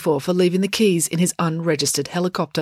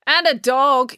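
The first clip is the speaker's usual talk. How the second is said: pointed, higher interjection over a speech; interrupting; 8 semitones higher, 5 dB louder, slower than usual. A narrator's reading. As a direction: cut in high and loud. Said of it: "interrupted April".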